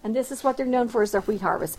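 normal talking voice

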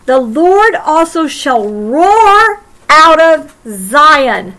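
slow, loud proclamation; proclaimed. Acted. Preacher reading Joel to the congregation, slow and booming. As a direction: boom it out slowly like a roar.

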